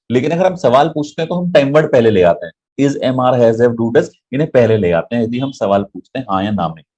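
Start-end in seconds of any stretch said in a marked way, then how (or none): none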